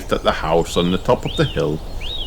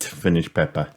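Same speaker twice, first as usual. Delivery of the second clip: ordinary delivery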